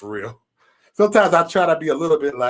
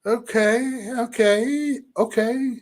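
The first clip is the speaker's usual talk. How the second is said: higher, drawn-out dubious okays; skeptical humoring; 7 semitones higher, much slower than usual. Retold or imitated. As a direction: humor them with stretched, pitched-up okays. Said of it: "And I just look like".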